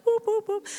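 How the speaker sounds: high, quick popping-up whoops; comic high "whoop, whoop" effect; playful whooping noises. Retold or imitated. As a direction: imitate someone popping out of nowhere. Imitates Jesus popping up everywhere.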